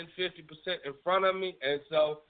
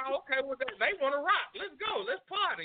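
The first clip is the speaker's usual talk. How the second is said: excited; 8 semitones higher than usual. Retold or imitated. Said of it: "I be like".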